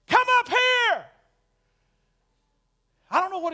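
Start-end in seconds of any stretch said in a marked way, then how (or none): 0.1-1.1 high, loud, stretched-out shout; commanding shout. Shown as a demonstration. Jesus calling the Apostle John up, loud shout. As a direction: belt it out as a summons. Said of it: "he didn't say it like that".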